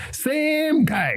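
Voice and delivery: high, exasperated "same guy"; can't believe it's him again; indignant. Real, not acted. Outraged at the repeat theft.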